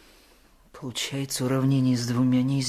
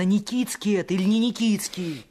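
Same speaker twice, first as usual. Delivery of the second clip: heated objection; 9 semitones higher, 4 dB louder than usual. Acted. Boys arguing about the suspect.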